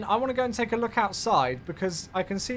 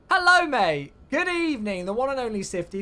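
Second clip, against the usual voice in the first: cheery welcome; 5 semitones higher, 6 dB louder than usual. Real, not acted. Welcoming viewer Sifty.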